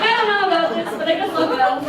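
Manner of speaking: high, loud, giggling reluctance; mock the timid follower, laughing through it; laughing hesitant agreement; laughing sister. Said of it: "she's like".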